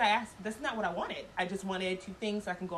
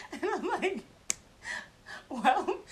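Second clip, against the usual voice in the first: higher, louder word through a laugh; 8 semitones higher, 3 dB louder, slower than usual. Real, not acted. Telling how she answered him, laughing. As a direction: get the word out mid-giggle. Laughing at her own story.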